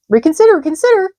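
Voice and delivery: very high, loud honking goose cries; a goose barking orders to the pig; shrill-pitched urgent goose shouts; excited goose. A narrator's reading. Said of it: "cried the goose".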